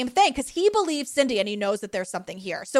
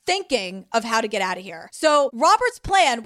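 ordinary talk, nothing marked

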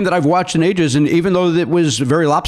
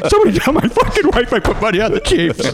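hammed-up fake crying, higher, laughing through it; mock sobbing; 9 semitones higher than usual. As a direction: pretend to sob in mock despair. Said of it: "I'm just here crying, going".

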